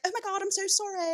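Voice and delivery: high, rushed mock apology; breathless sorry-sorry; flustered apology. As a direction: gush it out like a late, flustered guy. Late student apologizing, quoted.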